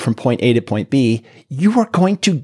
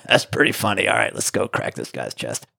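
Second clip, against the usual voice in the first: gruff gravelly voice; 4 semitones lower, 6 dB quieter than usual. Retold or imitated. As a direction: drop low and rasp it out, unbothered. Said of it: "talked like this at this same tone and had this gravelly voice".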